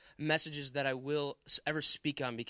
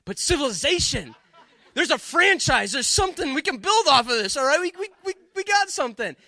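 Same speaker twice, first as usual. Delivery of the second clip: higher and louder, laughing through the words; giddy with relief, bubbling over with laughter; 9 semitones higher, 10 dB louder than usual. Retold or imitated. Preacher to the congregation, laughing, higher and louder with excited relief.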